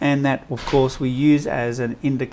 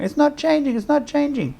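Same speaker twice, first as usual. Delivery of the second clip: much higher, mock-panicked whine; high-pitched panic; 13 semitones higher than usual. Students panicking over the lime water, high-pitched mock panic. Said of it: "they do that in that high-pitched voice".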